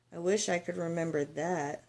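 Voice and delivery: soft, wistful sigh of longing; softly sighing, wishing she could remember. Acted. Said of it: "Lola sighed".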